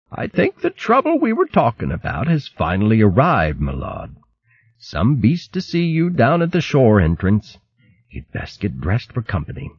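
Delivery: lightly voiced old retainer; old servant voice. Ancient servant warns.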